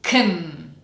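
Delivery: KUN, k going straight into n; reduced can. Shown as a demonstration. Teacher has learners repeat after her, vowelless reduced can. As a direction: say KUN without any vowel sound. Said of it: "repeat after me".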